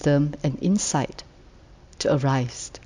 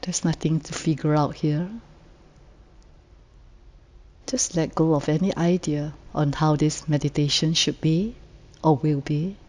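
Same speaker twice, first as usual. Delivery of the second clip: guided meditation; 4 dB quieter than usual. Real, not acted. Meditation leader inviting the group to settle and let go, calm and unhurried. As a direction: gently lead sitters into rest, phrase by phrase.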